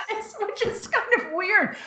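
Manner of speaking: laughing self-consciously. Real, not acted. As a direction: giggle while admitting something odd. Laughing at her own habit.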